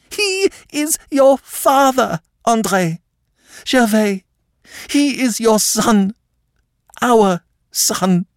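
high, gasping, halting distress; near hysteria. A narrator's reading. Distracted Countess reveals the truth to both men, near hysterical. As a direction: force out broken phrases in high-pitched anguish. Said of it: "on the verge almost of hysteria".

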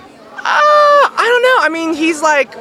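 high-pitched, doubtful squirm; awkward hedging. Real, not acted. Guest breaks bad news about Finn and Bubblegum's age gap, awkward hedging.

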